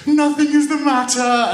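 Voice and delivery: put-on loud, high childish wail; acted tearful bawling; crying. A narrator's reading. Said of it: "and cried all the more".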